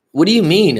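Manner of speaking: retold indignant question; taken aback. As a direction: push back in disbelief. Retold challenge to the accusation.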